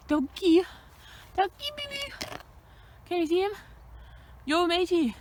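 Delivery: high cooing call; high-pitched greeting to a pet. Real, not acted. Calling to an animal she spotted.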